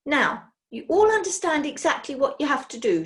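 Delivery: bossy witch. Acted. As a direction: command the room like Napoleon.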